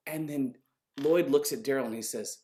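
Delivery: usual speaking voice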